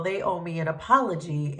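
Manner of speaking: usual speaking voice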